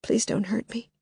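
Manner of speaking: soft, frightened whisper; Lily begging not to be hurt, whispering; whispered plea. Acted. Terrified whisper. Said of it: "she said".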